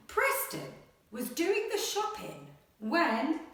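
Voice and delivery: animated picture-book read-aloud; reading to children. Acted. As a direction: read it like a bedtime adventure. Teacher reads story aloud.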